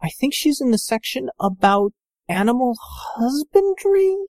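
put-on shy young woman's voice; female voice.

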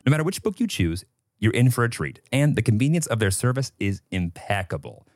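upbeat sponsor read, smooth and polished. Acted. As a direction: sell the book deal like a podcast ad.

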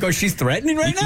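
high-pitched mock alarm; mock alarmed onlookers. Co-host teasing about how onlookers would react, mock alarm. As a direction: imitate worried strangers, pitched well up.

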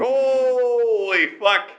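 high, very slow holy fuck; stunned, dragged out; drawn-out disbelief. Real, not acted. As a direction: stretch it out in shock. Reacting to absurd doctor quote.

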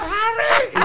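high loud call of the name; woman's shout. Retold or imitated. Passenger shouting at the comedian before takeoff. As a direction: yell it like a woman calling across a plane.

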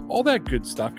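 normal talking voice

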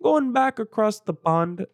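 attempted British accent, pitched high and slow; badly faked British accent, drawn out. Shown as a demonstration. Podcaster trying out a British accent he admits he lacks, British accent. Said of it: "If I could do a British accent".

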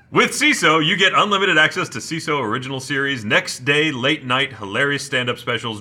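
upbeat sponsor read, building to an exclaimed punchline; energetic promo delivery. Acted. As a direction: pitch it like a podcast host reading copy. Reads the sponsor ad copy.

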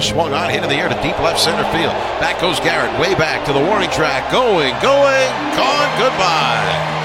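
rising excited radio call; home run call. Acted. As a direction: build the call to a big finish.

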